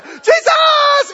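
a very high, loud cry of "Jesus". Retold or imitated. Bartimaeus shouting over the crowd, very loud and high. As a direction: cry out above them all. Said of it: "Got louder".

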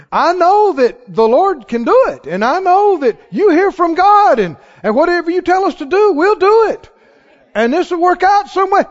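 eager, believing reply; faith-filled tone. Shown as a demonstration. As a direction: sound sure God will come through. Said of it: "Can you hear the difference in the tone".

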